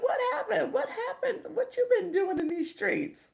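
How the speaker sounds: squealing the question high through laughter; teasing a chat friend in a high laughing squeal. Retold or imitated. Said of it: "I was like".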